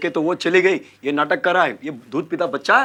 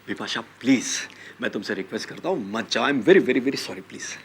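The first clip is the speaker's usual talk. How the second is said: low, much softer begging; whisper-soft sorry to win her back; soft pleading apology; 7 semitones lower, 4 dB quieter than usual. Acted. Husband apologizing softly. (बहुत धीमे से माफ़ी माँगते हुए)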